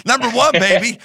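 loud, high hammed-up boast; playful over-the-top American hollering; mock patriotic cheer. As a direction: crow it like a joking superfan. Jokey patriotic boast, laughing after.

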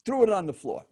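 ordinary delivery, nothing marked

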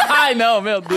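loud, high giggling exclamation; laughing. Real, not acted. Laughing at the joke. (rindo alto)

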